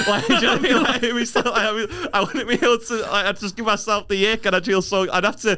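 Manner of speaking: high, fast laughing rant. Real, not acted. To his co-host about assimilating, high laughing babble.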